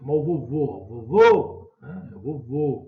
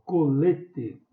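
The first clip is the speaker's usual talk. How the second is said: "colete" slowed, with LE punched; teacher's demo of "colete", middle syllable stressed; stressed penultimate syllable; 5 dB louder than usual. Shown as a demonstration. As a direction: stretch "colete" as co-LE-te. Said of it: "é o lê".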